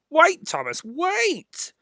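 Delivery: high squeaky truck voices; silly trucks calling. A narrator's reading. Trucks begging Thomas to wait, high squeaky calls. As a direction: cry out after the engine.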